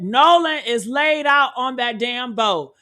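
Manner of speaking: normal talking voice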